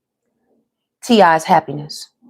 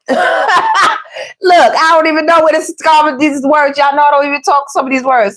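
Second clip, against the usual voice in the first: amused disbelief; 7 semitones higher than usual. Real, not acted. Reader to viewers about own odd wording, laughing disbelief. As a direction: laugh while pleading bafflement.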